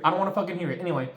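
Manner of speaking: normal talking voice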